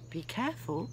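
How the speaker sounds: ordinary delivery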